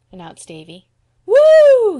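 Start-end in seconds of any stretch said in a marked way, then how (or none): 1.2-2.0 high, loud drawn-out "woo"; whooping yell. A narrator's reading. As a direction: whoop it out like excited kids. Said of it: "yelled the Cottons".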